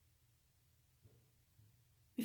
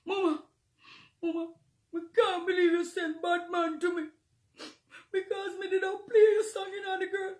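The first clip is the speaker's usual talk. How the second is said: high, loud wailing plea; mock the selector crying down the phone; imitating the crying selector; 6 semitones higher, 4 dB louder than usual. Selector calling Mama in tears, high crying shout. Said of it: "the selector called me crying".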